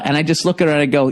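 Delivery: usual speaking voice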